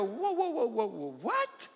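mock shock, leaping very high; retold disbelief. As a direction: whoa it out rapidly up to a squeaked what. Reacting to what he read.

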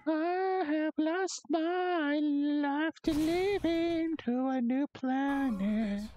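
very high, slow, drawn-out wail; high-pitched mock lament. Player mourning a lost life in game, high drawn-out mock lament. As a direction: stretch it out way up high, slowly.

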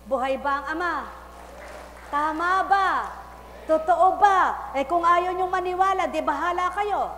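loud, high fiery preaching; preaching at full force. Acted. Preacher calling out to the congregation, fiery and loud. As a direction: call it out to the crowd.

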